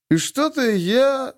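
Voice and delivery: put-on high, fumbling drunk mumble; drunken mumble. A narrator's reading. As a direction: mumble it while swaying. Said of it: "мямлил мужчина". (пьяно мямлит)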